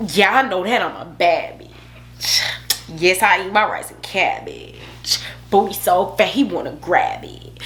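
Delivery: cocky, sing-song brag; sassy swagger. Retold or imitated. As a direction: strut it like a bad bitch anthem. Mock swagger quote walking into school.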